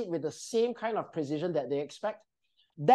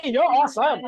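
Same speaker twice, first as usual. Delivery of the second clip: upbeat praise; 5 semitones higher, 7 dB louder than usual. Shown as a demonstration.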